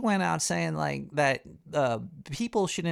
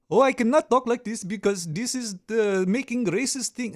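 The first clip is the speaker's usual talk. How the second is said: put-on higher, louder Apu-style Indian accent; put-on Indian accent, pitched up; mock Indian accent; 5 semitones higher, 4 dB louder than usual. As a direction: imitate a cartoon Indian accent. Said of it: "you're imitating an accent".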